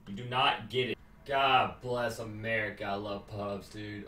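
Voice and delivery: normal talking voice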